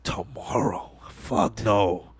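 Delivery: mock whisper. [name] mocking, retold movie scene.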